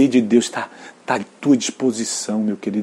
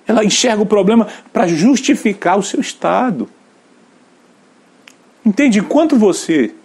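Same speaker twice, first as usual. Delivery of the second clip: much higher, louder, at full force; fiery preaching; 10 semitones higher, 4 dB louder than usual. Real, not acted. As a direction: drive it home from the pulpit.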